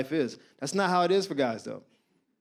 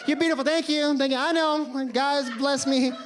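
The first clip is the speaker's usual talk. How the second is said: lightly mimicked high-pitched woman; mock gracious woman, pitched up; complimented woman; 6 semitones higher than usual. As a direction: toss off thanks in a higher voice. Mimics women receiving constant compliments.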